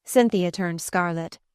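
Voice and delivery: ordinary delivery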